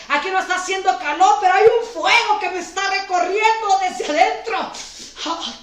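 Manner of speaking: preaching loud and fast, building to shouts; prophesy over the church at full force; fervent preaching. Acted. Pastor prophesying to the congregation, fervent preaching.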